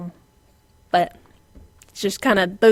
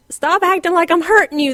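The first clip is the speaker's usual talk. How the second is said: higher, louder exasperated scold; scolding the dogs; 9 semitones higher, 8 dB louder than usual. Retold or imitated. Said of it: "all the while I'm going".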